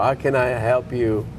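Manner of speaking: usual speaking voice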